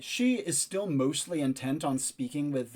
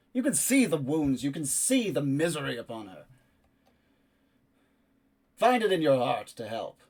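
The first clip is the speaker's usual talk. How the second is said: louder, pleading up to the keep; loud pleading call; 7 dB louder than usual. Acted. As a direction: project a plea to the guards above. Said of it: "loudly enough to be heard. It's not tender and soft".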